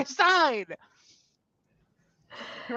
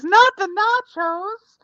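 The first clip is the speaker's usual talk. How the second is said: replaying it; 10 semitones higher, 4 dB louder, slower than usual. Shown as a demonstration. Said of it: "listened to that, by the way, as".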